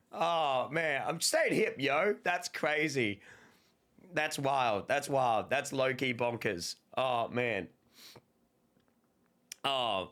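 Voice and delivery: ordinary talk, nothing marked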